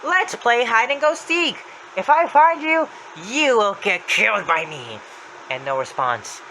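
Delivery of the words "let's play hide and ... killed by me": high, slow, taunting threat; Happy's voice. Retold or imitated. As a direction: call out the game loudly, drawing out the threat. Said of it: "He says".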